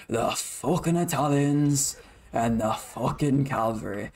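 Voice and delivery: slow, gruff Brummie gangster line; Thomas Shelby impression. Gamer attempting the Peaky Blinders accent mid-match, slow Brummie. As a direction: drawl it out in a light Birmingham accent. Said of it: "so hard to do his fucking accent".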